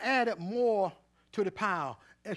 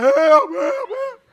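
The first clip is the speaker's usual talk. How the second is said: much higher and louder, slow, stretched cries; drawn-out holler for help; 14 dB louder, much slower than usual. Retold or imitated. Pastor reliving his near drowning for the church, in a slow high hollered cry for help. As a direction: wail it like you're drowning. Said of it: "And I was hollering".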